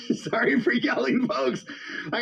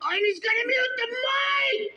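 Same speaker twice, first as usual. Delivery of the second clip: angry shout; 12 semitones higher, 3 dB quieter than usual. Real, not acted. Streamer reacting angrily to muting.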